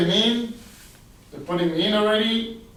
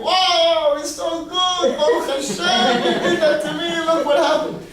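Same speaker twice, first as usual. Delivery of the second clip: high, loud elated thanks; hammed-up jubilant relief; happy king; 11 semitones higher, 6 dB louder than usual. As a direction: exclaim it overjoyed. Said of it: "this king is happy".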